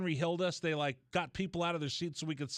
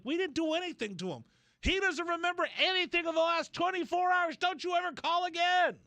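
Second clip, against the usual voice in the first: mock furious woman; 13 semitones higher than usual.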